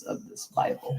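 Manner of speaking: mock hushed awe. Joking about the heavy Bible.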